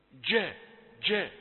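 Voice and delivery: "جاء" with a hard stopped dj; short firm jeem on "جاء". Shown as a demonstration. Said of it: "اجعلها شديدة". (جيم شديدة في "جاء")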